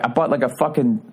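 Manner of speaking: normal talking voice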